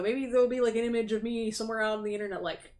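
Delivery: usual speaking voice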